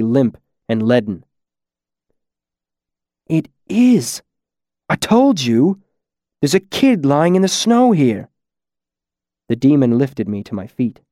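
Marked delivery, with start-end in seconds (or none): 3.3-8.3 higher-pitched, exclaiming character voice; excited discovery. Acted. Masked figure spots a kid lying in the snow, excited. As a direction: call out the find, voice swooping high.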